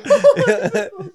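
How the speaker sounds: big laugh; high laughter. Real, not acted. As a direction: keep laughing. Keeps laughing.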